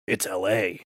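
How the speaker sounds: put-on low, quiet botched American accent; bad American accent. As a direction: do Liam Neeson failing at American, low and muted. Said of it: "he can't do an American accent".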